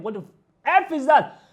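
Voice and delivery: loud, sharp burst of real anger; angry outburst. Real, not acted. Ranting vlogger snaps at the video clip, angry. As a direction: bark it out, fed up with what he saw. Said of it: "really pissing me off right now".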